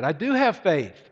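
loud, high, pleading protest; shouted insistence. Acted. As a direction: shout your answer up the cliff. Hanging man shouts back up.

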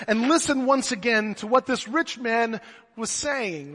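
ordinary delivery

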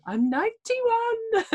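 put-on high, slow old-timer boast; old person voice. Mocking old age among friends, high slow old-lady voice. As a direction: proudly announce your age like a very old lady. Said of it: "start telling people how old you are".

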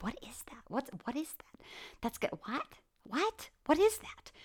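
from an unvoiced whisper up to a high alarmed squeak; whisper rising to alarm. To her listeners, voicing the frightened mind, whisper to high alarm. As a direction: start hushed and puzzled, then climb into a startled high "What?".

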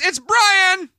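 loud, high, excited announcement; big high-energy reveal; very upbeat introduction. Real, not acted. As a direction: belt out the guest's name like a host. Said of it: "I felt like I was very upbeat".

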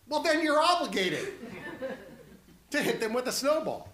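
high, loud punchline with laughter; joking, amused. Real, not acted. Preacher joking about double negatives in snowball fights, amused. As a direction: laugh through a mock-logical payoff.

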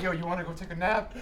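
sheepish whisper-ish ask. Retold or imitated. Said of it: "they were looking at each other like".

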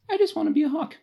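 high, longing mock Tobias voice; wistful Tobias. Friend imitates Tobias longing to be a hawk, high and wistful. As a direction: sigh it out like a dreamy kid. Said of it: "he's like".

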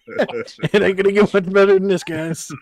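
laughing out the words, loud; loud amused giggle through it. Real, not acted. Jokes while laughing.

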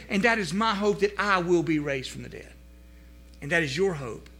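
ordinary delivery

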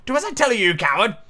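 high-pitched scolding, dropping at the end; mildly angry high character voice; cross Rabbit. A narrator's reading. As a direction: berate the servant, starting high. Rabbit berates servant.

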